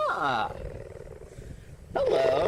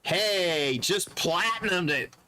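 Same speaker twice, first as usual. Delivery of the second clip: usual speaking voice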